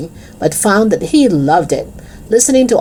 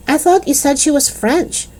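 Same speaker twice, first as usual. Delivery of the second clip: high, innocent-sounding query; 9 semitones higher than usual. A narrator's reading. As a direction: say it innocently, pitched well up. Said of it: "said Mac innocently".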